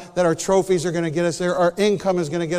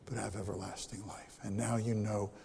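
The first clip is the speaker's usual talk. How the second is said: hushed closing; 9 semitones lower, 13 dB quieter than usual. Real, not acted. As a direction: let it die into a whisper. Sermon closes in whisper.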